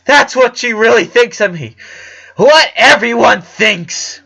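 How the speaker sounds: high, loud and hurt; upset shout. A narrator's reading. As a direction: shout it out, wounded. Hurt sister outburst.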